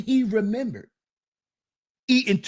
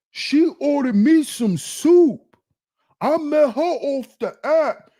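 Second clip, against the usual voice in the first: excited, higher mock gushing; 4 semitones higher, slower than usual. Imitating a young man bragging about his soup date. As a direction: gush like a naive tourist.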